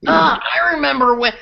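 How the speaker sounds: usual speaking voice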